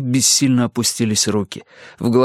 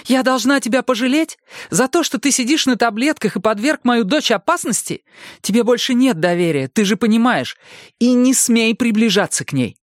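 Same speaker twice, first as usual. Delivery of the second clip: furious mother. A narrator's reading. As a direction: tear into him, protecting her daughter. Mother raging at Erik.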